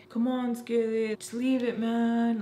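slow mock plea; imitated pleading. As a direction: act out a friend urging someone to drop it. Friend pleading to stop the fight.

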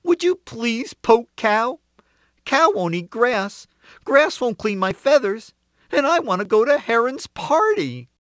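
pleading rooster. A narrator's reading. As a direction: list the chain in a high, begging voice. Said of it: "he asked".

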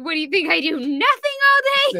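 mock indignant, rising to a high yell; Dan. Cohost mimicking Dan's flip-out, mock irate. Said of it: "He was like".

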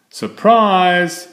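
bright shouted "Surprise!". A narrator's reading. Franklin shouts to his parents, springing a loud surprise. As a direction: call it out to the parents. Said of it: "Franklin shouted to his parents".

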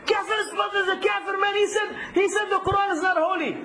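high-pitched indignant accuser impression; mock outrage. Teacher mimics people who call him kafir, high mock outrage.

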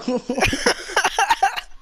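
high-pitched laugh. Real, not acted.

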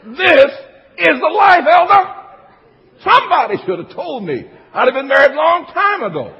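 high, loud mock rapture; hammed-up elation; gushing newlywed. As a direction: proclaim married bliss like a smitten newlywed. Newlywed's delight acted out again.

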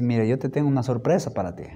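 soft and quick, a hint of a tender wife; wife hinting at a pregnancy surprise, soft and quick; secret surprise. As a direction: tease a happy secret softly.

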